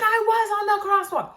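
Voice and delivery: high, indignant denial; indignant lady. Retold or imitated. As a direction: snap back like the lady. Lady denies in argument.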